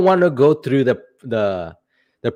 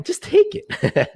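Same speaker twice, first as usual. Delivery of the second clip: "Just take it" stretched out slowly at a much higher pitch; hammed-up falsetto, drawn out; mock high, slow squeal; much slower than usual. Shown as a demonstration. Streamer jokes to the chat, mock squeal, slow and high.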